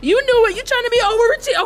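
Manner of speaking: delighted scolding of an eager dog; excited praise. Real, not acted. Owner praising her overeager dog, rising excitement. Dog does trick early.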